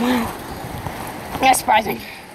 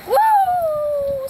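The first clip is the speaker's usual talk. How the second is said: excited; 12 semitones higher than usual. Real, not acted. Cheering sign-off.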